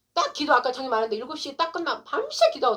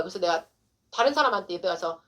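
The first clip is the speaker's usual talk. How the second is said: ordinary delivery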